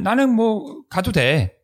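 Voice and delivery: high, slow, easygoing quote; high carefree voice. Retold or imitated. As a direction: voice Billy's relaxed thought lightly. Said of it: "빌리가 자기 자신을 먼저 생각하는 거예요". (높고 느긋한 목소리)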